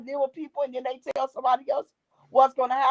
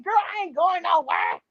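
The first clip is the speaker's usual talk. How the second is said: reassuring mother; 6 semitones higher than usual. Retold or imitated. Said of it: "She said".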